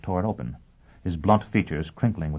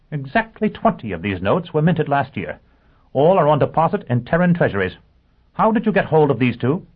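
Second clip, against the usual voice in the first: high, forceful interrogating voice; demanding lawyer, excited; 9 semitones higher, 7 dB louder than usual. Acted. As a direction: press the client in a raised voice. Character questions a client.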